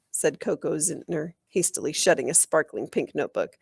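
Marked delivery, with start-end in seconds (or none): none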